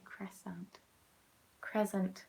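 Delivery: "crescent" as soft English KREZ-ent; plain English pronunciation. Shown as a demonstration. Giving up on French, "crescent" as English KREZ-ent. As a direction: say "crescent" quietly, fully English. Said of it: "I just sound English".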